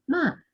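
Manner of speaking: "ma" starting high and falling sharply; fourth tone. Shown as a demonstration. Teacher reciting the four tones, falling "ma". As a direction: drop "ma" quickly from a high start. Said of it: "ahí van los cuatro tonos del chino".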